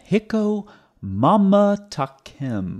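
exaggerated parody of the quote; slow mocking imitation. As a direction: stretch out "mamtakim" in a high mock voice. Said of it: "what the Hebrew actually says".

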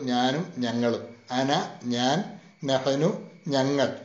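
drawn-out, careful reading of അന and നഹനു; the teacher slowly reads out the first person pronouns; slow, deliberate. Shown as a demonstration. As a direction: spell out അന ഞാൻ, നഹനു ഞങ്ങൾ very slowly for students. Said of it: "first പേഴ്സണിൽ വരുന്നതിൽ രണ്ടെണ്ണേ ഉള്ളൂ".

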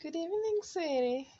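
tender greeting. Real, not acted. Mother greets baby tenderly.

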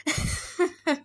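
Gruffalo's bursting laugh; hearty acted laughing; monster laughter. Gruffalo laughs at the mouse's boast, amused. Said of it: "bursting with laughter".

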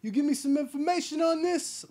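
high, loud put-on tough-guy demand; Seagal bar interrogation. Seagal demanding info in a bar, loud put-on tough voice. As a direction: lean on the bartender for info.

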